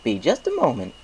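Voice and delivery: high, friendly shopkeeper voice; chatty baker pleased with sales; cheerful baker voice. Acted. Baker character voice.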